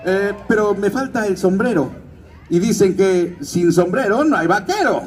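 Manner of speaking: vaquero de juguete, voz más aguda; Woody. Shown as a demonstration. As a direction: haz de vaquero animado quejándose de su sombrero. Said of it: "que se llama Woody". (voz de Woody)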